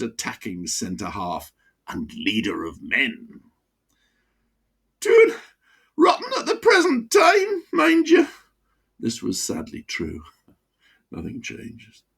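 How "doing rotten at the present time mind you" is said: put-on high, broad Wearside voice of an old miner; chatty old pitman with a wheezy chest. Old miner to his doctor, broad Wearside. Mickey on Sunderland.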